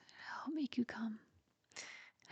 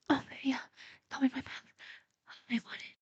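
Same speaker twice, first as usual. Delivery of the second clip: eager moaning; 3 semitones higher, 7 dB louder, faster than usual. Acted. Urging partner to climax.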